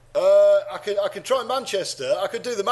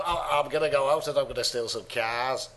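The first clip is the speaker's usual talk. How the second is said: put-on Lancashire voice, dropping lower; Lancashire accent; 4 semitones lower, 4 dB quieter than usual. Podcaster acting out would-be thief, Lancashire accent. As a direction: play a Lancashire car thief. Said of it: "That's Lancashire".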